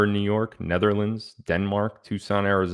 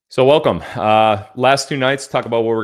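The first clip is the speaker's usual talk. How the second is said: loud welcome; 3 semitones higher, 8 dB louder than usual. Real, not acted. Energetic show welcome.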